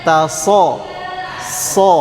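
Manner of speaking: "taso" with a heavy S, lips not pushed forward; thick S, lips relaxed. Shown as a demonstration. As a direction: thicken "so" from the back of the tongue, lips unrounded. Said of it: "bibirnya tidak maju". ("so" tebal tanpa memajukan bibir)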